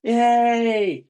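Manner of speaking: long, loud, high-pitched yay; cheering. Real, not acted. Cheering own bag, loud drawn-out whoop.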